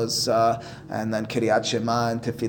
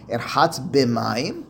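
normal talking voice

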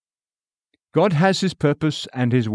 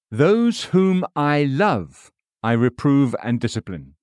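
"love" drawn out slowly and leaned on; slow the line down and weigh "love"; stress on love; slower than usual. Shown as a demonstration. Preacher quoting scripture, slow weight on "love". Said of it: "Emphasis added".

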